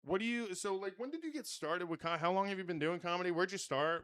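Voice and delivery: ordinary delivery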